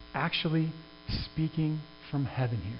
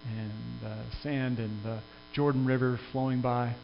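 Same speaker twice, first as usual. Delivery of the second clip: a pastor softly walking listeners into the riverbank scene; hushed guided imagining; 4 semitones lower, 6 dB quieter than usual. Acted. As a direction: draw the congregation into the picture, hushed. Can you imagine being there?.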